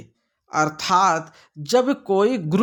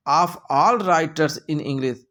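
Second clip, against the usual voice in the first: slow reading aloud; much slower than usual. Shown as a demonstration. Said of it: "reads option slowly".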